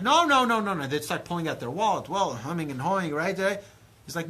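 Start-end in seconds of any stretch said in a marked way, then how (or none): 0.0-0.9 higher, louder no no no; mock Abraham refusing. Abraham refusing the guests' money, louder. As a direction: wave off the wallet insistently.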